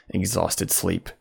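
normal talking voice

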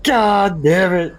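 slow, high, dragged-out groan of grief; anguished groan. Acted. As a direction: drag it out in despair. Player reacts to head snapping.

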